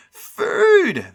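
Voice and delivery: very high, loud monster squeal; crying out. A narrator's reading. Monsters seeing food on the bed, crying out. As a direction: squeal with greedy delight. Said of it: "they cried".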